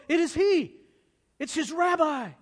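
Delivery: loud, high shout of recognition; astonished cry. Acted. Simon recognizes his rabbi on the road, astonished cry. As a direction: cry out in sudden amazement.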